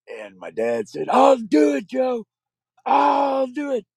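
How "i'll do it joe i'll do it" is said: high, loud threatening yell; furious shout. Retold or imitated. Father threatening the mother, high and loud. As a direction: shout the threat back at her. Father yelling back with gun.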